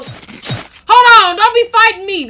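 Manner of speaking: loud, high playful outcry; alarmed protest. Pretend. Player being attacked by Junior, loud protest. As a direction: cry out to stop the attack.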